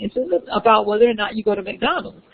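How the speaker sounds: ordinary delivery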